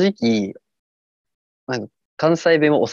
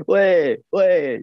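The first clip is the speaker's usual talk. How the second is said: mock ball call; 8 semitones higher, much slower than usual. Shown as a demonstration. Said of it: "少年野球のボール呼ぶ声やな".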